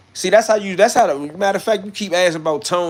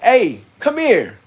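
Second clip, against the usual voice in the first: high, loud, drawn-out summons; calling out; 9 semitones higher, 6 dB louder, much slower than usual. Retold or imitated. Tone calling the homie over in a retold story, calling out. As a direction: holler someone over, slowly.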